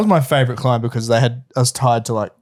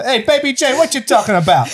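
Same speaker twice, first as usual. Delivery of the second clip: Boston gangster; 12 semitones higher than usual. Retold or imitated. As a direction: shout it like a Boston mob guy. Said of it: "I was enjoying doing the".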